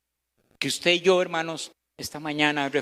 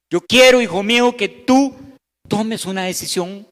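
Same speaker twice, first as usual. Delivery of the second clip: voicing the Lord; 4 semitones higher, 5 dB louder than usual. Preacher voices the Lord's call.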